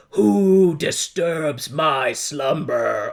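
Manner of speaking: very slow drawn-out monster voice; booming giant head. Retold or imitated. Giant head demanding who disturbs it, very slow. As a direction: drag it out like a dragon woken up. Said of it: "yells at them".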